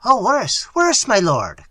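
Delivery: high, loud servant's outburst; agitated cry. A narrator's reading. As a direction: cry out to warn your master. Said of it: "cried Diego".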